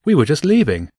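mild call up to someone overhead. A narrator's reading. Joe to the flyers over the car park, calling up. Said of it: "Joe called up to them".

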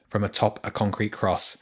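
usual speaking voice

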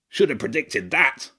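lightly voiced wry badger king; a rueful badger king, half to himself. A narrator's reading.

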